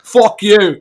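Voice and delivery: badly faked Scottish insult; shaky Scottish accent. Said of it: "I can't do that accent".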